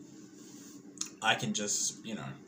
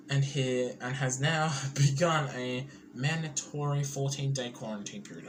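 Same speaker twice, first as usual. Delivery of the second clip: usual speaking voice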